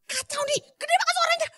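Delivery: high, hushed fluster; mock panic. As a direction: panic quietly at an embarrassing noise. Acts out panic at own loud recording.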